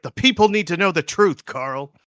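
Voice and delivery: high, loud mock-dramatic proclamation; indignant Donut. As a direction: proclaim it to Carl like a crusader. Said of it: "And she's like".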